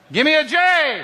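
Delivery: high, loud stadium cheer call; cheer shout. Real, not acted. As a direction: shout it up to the rafters for the crowd. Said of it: "have a little Jesus cheer".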